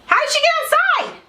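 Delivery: very high, startled question; sudden high-pitched parental alarm; alarmed exclamation. Real, not acted. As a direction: cry out in real surprise, pitch leaping up. Reacts to child being outside.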